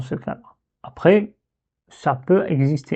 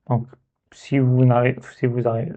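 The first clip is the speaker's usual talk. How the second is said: normal talking voice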